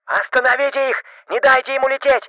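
loud harsh yell through a loudspeaker; through a megaphone. A narrator's reading. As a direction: bellow orders over a megaphone. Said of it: "брат заорал". (резкий крик через громкоговоритель)

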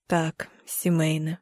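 low, slow, hushed man's voice; subdued, deep murmur with pauses; quiet reply. A narrator's reading. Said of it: "говорить тихо".

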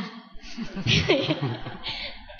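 soft airy embarrassed laugh; breathy laugh. Real, not acted. Student shyly finishing her report to the teacher, soft breathy laugh. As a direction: let out a quiet breathy chuckle.

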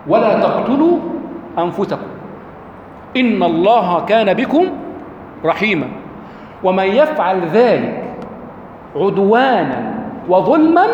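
slow, drawn-out chanted verse; unhurried intoned recitation; slow Quran recitation. Acted. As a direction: recite each phrase long and measured, as in tajweed. Verse recited slowly in full.

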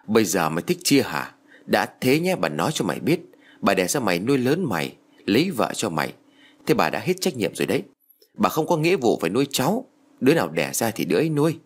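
ordinary delivery, nothing marked

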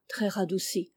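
ordinary delivery

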